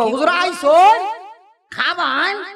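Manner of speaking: high, drawn-out Mymensingh dialect; Mymensingh drawl. Retold or imitated. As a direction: stretch the vowels like Mymensingh folk. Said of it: "Mymensingh অঞ্চলে গেলে দেখবেন খালি টানাটানি".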